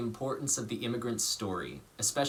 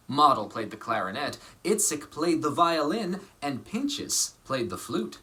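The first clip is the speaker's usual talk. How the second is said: lively picture-book reading voice; story narration. A narrator's reading. Actor reading a picture book to children, lively storytelling. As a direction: read it warmly to children.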